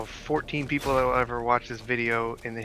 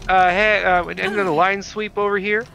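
coworker code call; 7 semitones higher, 5 dB louder than usual. Retold or imitated. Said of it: "we would go".

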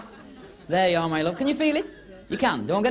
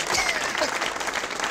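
squealing breathy giggle; high silent laugh; high wheezy laugh. Real, not acted. High laugh after thanks.

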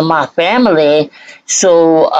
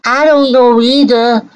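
sing-song mimicry of the woman in the clip, pitched higher; mocking echo; 6 semitones higher than usual. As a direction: parrot her words back with a sneer.

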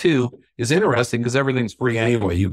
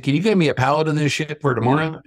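higher-pitched Kentucky imitation; heavy Kentucky accent; 6 semitones higher than usual. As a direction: ask it as a Kentucky good old boy. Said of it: "in his heavy Kentucky accent".

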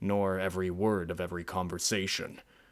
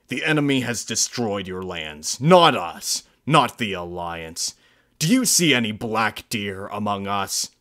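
angry rebuttal; 9 dB louder than usual. Acted. Narrator angrily confronts pronghorn.

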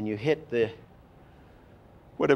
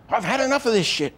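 higher, louder, fast blurt of anger; fed-up outburst; 10 semitones higher, 5 dB louder, faster than usual. Shown as a demonstration. Someone who has had enough, blurting it out. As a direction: spit it out like you've finally snapped. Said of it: "the people do that".

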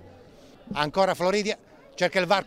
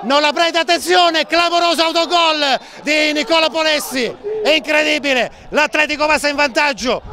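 excited goal call; 3 semitones higher than usual. Real, not acted. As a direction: shout it out, it's incredible. Own goal called live.

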